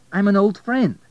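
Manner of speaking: high, loud emphatic claim; insistent protest. Acted. Abel protests her refusal.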